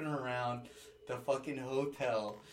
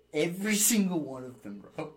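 louder, pitch jumps up; loud emphatic; 6 semitones higher, 9 dB louder than usual. Real, not acted. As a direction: punch the punchline out loud. Punchline of the story.

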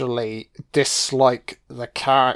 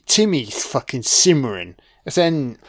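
ordinary delivery

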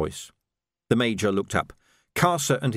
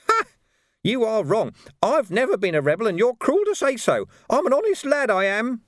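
tearful; 11 semitones higher, 3 dB louder than usual. A narrator's reading. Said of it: "Big tears began to run".

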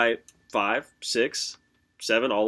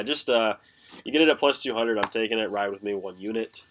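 ordinary talk

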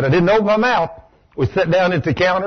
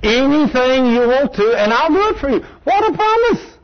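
exultant preaching; 7 semitones higher than usual. Real, not acted. Preacher exults over promise.

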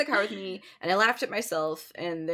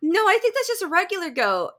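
mom correcting; 10 semitones higher, 8 dB louder than usual. Retold or imitated. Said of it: "she goes".

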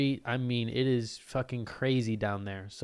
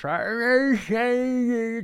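put-on much higher, louder slurred drunk cry; toothless drunk; 12 semitones higher, 6 dB louder, much slower than usual. Mocking the toothless drunk lady taking shots, high loud slur. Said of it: "no teeth".

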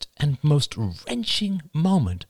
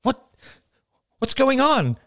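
high, panicky demand; alarmed cry. A narrator's reading. Rachel hears Oliver's unease and cries out in alarm. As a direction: cry it out, pitch leaping up. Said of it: "cried Rachel".

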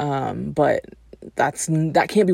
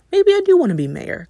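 higher, louder sudden enthusiasm; mock-eager new ambition; hammed-up delight at becoming mayor; excited idea; 14 semitones higher, 4 dB louder, faster than usual. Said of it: "and then is like".

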